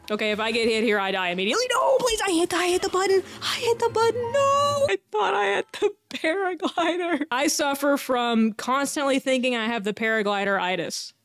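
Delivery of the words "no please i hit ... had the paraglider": high, pleading cry of dismay; panicked wail. Real, not acted. Gamer pleading as the paraglider is missing, panicked wail. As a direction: wail helplessly as it all goes wrong, high-pitched. Button fails, player falls to death.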